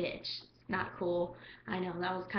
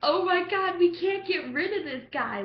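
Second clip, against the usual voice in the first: exasperated; 8 semitones higher, 5 dB louder than usual. Retold or imitated. Frustrated at clingy guy.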